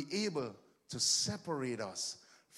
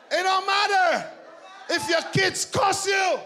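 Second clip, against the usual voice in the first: a step higher and louder each line until shouting; preaching at full force; 11 semitones higher, 9 dB louder than usual. Real, not acted. Preacher tells the church nothing can harm them, building shout. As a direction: hammer it don't matter up to a roar.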